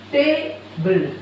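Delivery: "table" slowly broken into two beats; syllable by syllable. Shown as a demonstration. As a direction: say "table" as ta, then ble. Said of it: "pronounce different parts of words and syllables separately".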